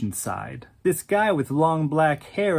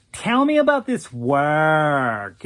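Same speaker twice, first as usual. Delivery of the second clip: slow nasal drawl, pushed a bit louder; nasally drawl; 4 dB louder, slower than usual. Acted. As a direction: drawl the request lazily, slow. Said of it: "in that nasally drawl".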